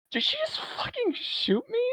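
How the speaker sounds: very high, squeaky shocked question; mock disbelief. Alice shot in the leg by Jordan, mock disbelief.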